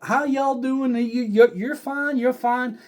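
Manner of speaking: high, slow Texas drawl; Southern clerk. Retold or imitated. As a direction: coo it like a sweet Southern clerk. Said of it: "she's like".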